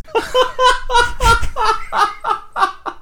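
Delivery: a high, helpless wheezing laugh. Real, not acted. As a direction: break up completely at the joke. Cracking up at joke.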